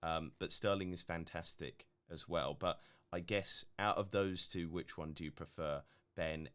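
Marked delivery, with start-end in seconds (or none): none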